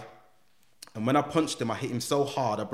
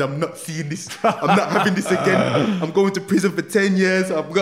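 much louder, higher, half laughing in despair; laughing panic; 7 semitones higher, 8 dB louder than usual. Retold or imitated. Alone at home the night before sentencing, panicking. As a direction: panic at the thought of prison.

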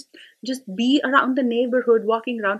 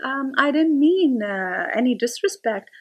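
mocking the son; 5 semitones higher than usual. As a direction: tease the kid's placating tone. Son calming mother, mocked.

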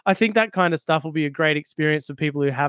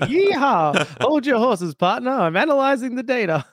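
cowboy voice; 8 semitones higher, 4 dB louder than usual. Pretend. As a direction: play a folksy cowboy, pitched up and loud. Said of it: "I should remember to speak like a cowboy".

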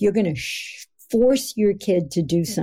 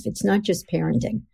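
ordinary talk, nothing marked

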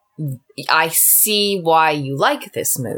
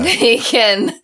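giggling through a repeated phrase; laughing; 7 semitones higher, 6 dB louder than usual. Real, not acted. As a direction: laugh it out, louder and higher. Laughing repeat of own line.

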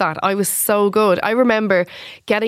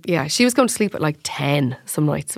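usual speaking voice

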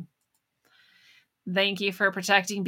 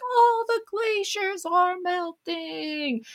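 much higher, slow mocking whine; mocking alarmist; 11 semitones higher, much slower than usual. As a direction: parody the alarmists in a sing-song whine. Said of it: "They're like".

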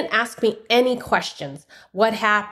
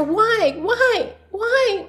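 high, pleading repeated cry; retold anguished plea; 12 semitones higher, much slower than usual. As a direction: re-enact a heartbroken question, pitched way up. Retold heartbroken questioning of God.

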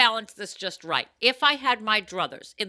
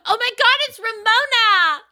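much higher, louder gasp of recognition; starstruck fan; 14 semitones higher, 11 dB louder than usual. Retold or imitated. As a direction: squeal like spotting a celebrity. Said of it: "turn around and be like".

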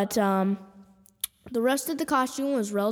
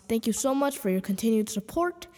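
ordinary talk

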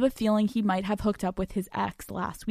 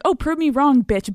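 higher, louder playful taunt; joking challenge; 5 semitones higher, 5 dB louder than usual. Acted. Girlfriend daring boyfriend to show his phone, joking challenge. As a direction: tease it as a dare. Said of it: "in a joking way".

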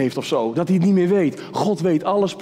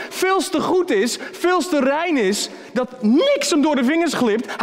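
impassioned preaching; 12 semitones higher than usual. Acted. Preacher warning sinners of judgment, impassioned. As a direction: preach it with rising fire.

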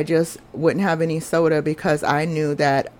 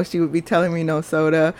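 ordinary talk

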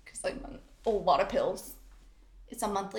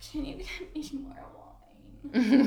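pleading whine; 5 semitones higher, 4 dB louder, much slower than usual. Retold or imitated. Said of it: "But then I'm like".

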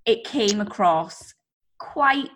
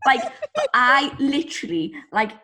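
usual speaking voice

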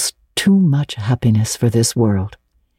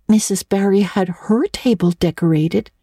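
Anne; 6 semitones higher than usual. A narrator's reading. Anne voiced in story.

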